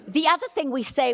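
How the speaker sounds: ordinary talk, nothing marked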